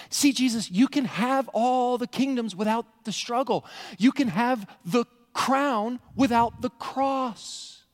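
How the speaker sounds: high, slow, drawn-out offer; coaxing tempter. Acted. Satan offering Jesus kingdoms without struggle, high and coaxing. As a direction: dangle each promise temptingly.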